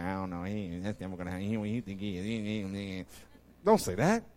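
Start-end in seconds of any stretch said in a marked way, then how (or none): 0.0-3.0 a low, soft mock scoffer muttering; lightly voiced doubter waving it off. Preacher mocking a doubter in the crowd, low and dismissive. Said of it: "prevent us from doing this by".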